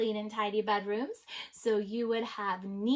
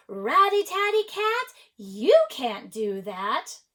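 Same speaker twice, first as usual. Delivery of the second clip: people scolding a cat; 3 semitones higher, 9 dB louder than usual. A narrator's reading. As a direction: scold the cat loudly, drawing out the last words. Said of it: "The people said".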